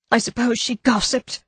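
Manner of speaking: sour, half to herself; bitter wife. A narrator's reading. Wife resenting the dead woman's gossip.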